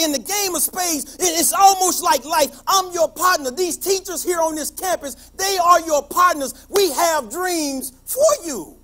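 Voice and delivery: high, loud preacher's build; preaching at full force. Acted. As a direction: lift the room like a sermon. Preaches to the students.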